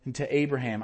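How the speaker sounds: normal talking voice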